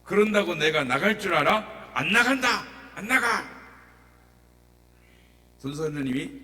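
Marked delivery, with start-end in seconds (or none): 0.0-3.4 loud, high defiant yell; possessed woman yelling she won't leave; defiant demon shout. Retold or imitated. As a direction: scream it back in refusal. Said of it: "소리를 막 지르고 발악을 합니다".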